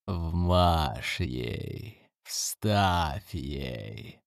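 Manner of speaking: low, hushed demonic urging; ghostly whisper. Acted. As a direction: egg it on like an unseen spirit. Invisible voices urge violence. (тихий зловещий шёпот духа)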